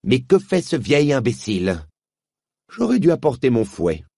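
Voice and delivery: impatient witch talking to herself. Acted. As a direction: snap it out, fed up with waiting. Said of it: "comme si elle s'adressait à elle-même".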